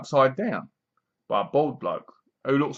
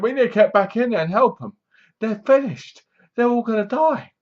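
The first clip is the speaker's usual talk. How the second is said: urgent plea; 8 semitones higher than usual. Retold or imitated. Shu urging return to help.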